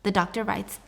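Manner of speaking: ordinary delivery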